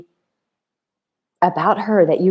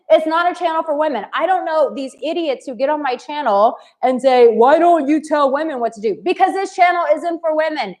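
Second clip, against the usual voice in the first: higher, exasperated lecture to a heckler; calling out a commenter; 6 semitones higher than usual. Real, not acted. Youtuber scolds a commenter who misread her channel, exasperated. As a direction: set the record straight, fed up and pointed. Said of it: "I'm just gonna call this out".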